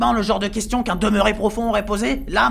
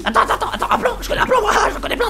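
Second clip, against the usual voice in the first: much higher, louder, rushed babbling; frantic excitement; 11 semitones higher, 5 dB louder, faster than usual. Acted. Crazed cellmate pitching his escape plan, frantic high babble. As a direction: spill the plan like a hyper maniac.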